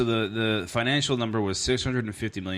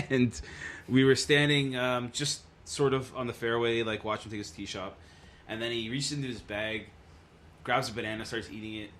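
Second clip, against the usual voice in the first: ordinary talk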